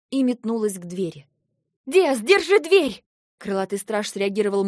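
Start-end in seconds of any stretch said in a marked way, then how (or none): 1.8-3.0 high loud drawn-out shout; shouted command. Acted. Heroine shouting to a guard while fleeing, yelled. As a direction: yell the order across the room.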